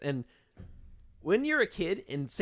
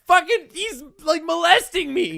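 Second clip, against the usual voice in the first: exasperated complaint; 11 semitones higher, 5 dB louder than usual. Retold or imitated. Exasperated retelling.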